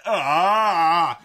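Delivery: long, loud mock scream; imitated scream. Showing how the box kid screams, imitated scream. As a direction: let out a sustained creature wail. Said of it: "it's, like, screaming".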